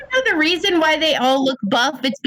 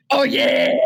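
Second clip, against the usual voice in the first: Kool-Aid Man. Acted. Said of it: "sound like the Kool-Aid Man".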